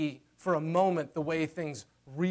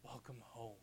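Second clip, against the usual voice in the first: very soft, slow, hushed welcome; God welcoming his child home, hushed and tender; tender father; 5 semitones lower, 19 dB quieter, slower than usual. Acted. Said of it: "Hearing God say".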